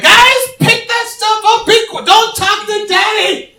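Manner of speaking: put-on high, loud shout at the kids; fed-up father yelling at messy kids; angry dad yell. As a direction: bark at the kids to pick up. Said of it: "Sometimes I'm like".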